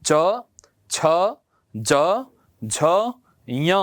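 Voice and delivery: slow, separated च छ ज झ ञ; teacher sounds out each letter; careful recital of the palatal row; slow consonant recital. Shown as a demonstration. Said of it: "उच्चारण करके बताता हूं".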